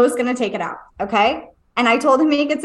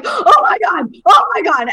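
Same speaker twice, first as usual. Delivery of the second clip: higher, louder imitation of a panicking son; hysterical child; 6 semitones higher, 6 dB louder than usual. Mom imitating her son with a stick in his foot, hysterical. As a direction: cry out like a hurt kid, retold. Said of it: "he's hysterical".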